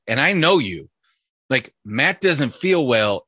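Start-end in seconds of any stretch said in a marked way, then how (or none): 1.8-3.2 "Matt doesn't feel well" slowly spelled out; pointed quotation. Shown as a demonstration. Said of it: "is code for".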